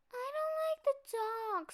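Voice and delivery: high small cub voice, scared; a tiny frightened cub; little bear cub. A narrator's reading.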